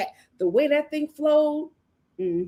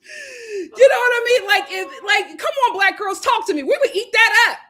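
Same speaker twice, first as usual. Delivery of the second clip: laughing, loud and high; 9 semitones higher, 4 dB louder than usual. Real, not acted. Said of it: "laughing while talking".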